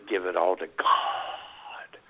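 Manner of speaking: slow whispered aside; mock reverent whisper. Co-host joking about the Jehovah's Witnesses, slow whisper. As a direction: hush it like a secret.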